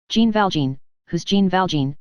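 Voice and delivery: usual speaking voice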